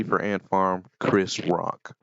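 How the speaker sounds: ordinary delivery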